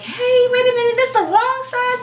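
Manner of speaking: high, put-on voice of a puzzled buyer; high mock double-take, wait a minute; surprised customer. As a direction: play the lady catching the mix-up. Voices customer noticing wrong size.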